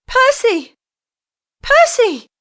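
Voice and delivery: high, loud desperate cries of a name; hysterical shriek. A narrator's reading. Wife shrieks for her hidden husband, hysterical. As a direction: scream for him, torn between doubt and hope. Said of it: "she shrieked hysterically".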